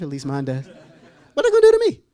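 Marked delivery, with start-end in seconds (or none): 1.3-2.0 hammed-up fearful high squeal; scared squeak. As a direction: shriek the question in mock panic. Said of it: "your voice goes up an octave".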